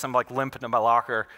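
ordinary talk

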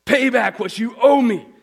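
high, loud furious demand; angry demand. Acted. Servant shouting at his fellow servant over a debt, loud and high. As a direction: yell at the debtor while choking him. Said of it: "he demanded".

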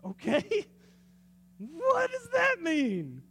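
high slow laugh through the words; puzzled, laughing disbelief; laughing question. Real, not acted. Preacher laughing at God's odd reply.

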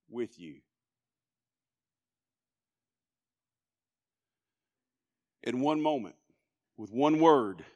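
low, soft, solemn appeal with long pauses; quiet, weighty pulpit voice; hushed preaching. Real, not acted. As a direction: speak gently into the silence.

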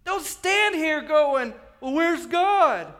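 high, loud, stretched-out words; slow, ringing pulpit rebuke; shouted rebuke. Acted. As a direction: scold the crowd at full preaching force. Acting Peter's rebuke of crowd.

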